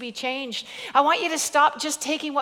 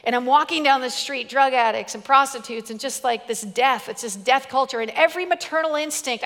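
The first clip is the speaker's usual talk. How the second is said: ordinary talk, nothing marked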